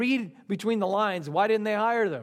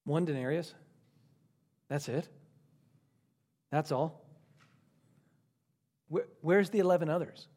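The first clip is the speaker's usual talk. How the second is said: disappointed; 5 semitones lower, 5 dB quieter than usual. Acted. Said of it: "Their disappointment turns into ingratitude".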